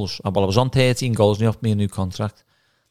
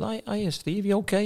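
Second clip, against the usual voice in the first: much higher, softer sing-song hello; sweet as pie, with a wink; mock friendly greeting; 11 semitones higher, 5 dB quieter than usual. Player greeting ex-manager at wedding, mock sweet hello.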